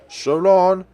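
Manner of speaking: high, loud goodbye shout; called-out farewell. Acted. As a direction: call it out to departing friends. Character calls out goodbye.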